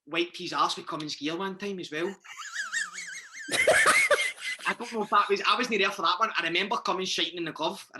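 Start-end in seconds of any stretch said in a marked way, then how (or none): 2.2-4.6 very high shrieking laugh. Real, not acted. Laughing at his own story.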